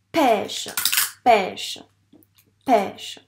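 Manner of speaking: "pêche" said loud, each time on its own; emphatic word modelling. Shown as a demonstration. Teacher naming the fruit for a pupil, "pêche" emphatic and loud. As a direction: give "pêche" loudly as a separate, clear word.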